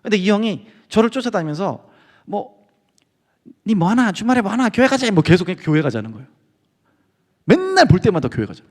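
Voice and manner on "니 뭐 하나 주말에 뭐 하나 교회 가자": put-on pushy older guy in a light Daegu accent; hint of Daegu, nagging him to church; Daegu dialect. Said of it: "대구에서 온 사람이었는데".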